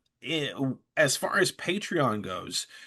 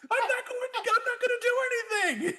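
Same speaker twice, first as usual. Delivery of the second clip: very high-pitched imitated protest; comic retold indignation; mock stubborn refusal; faster than usual. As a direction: dig in, voiced high for laughs. Retells wife refusing in quarrel.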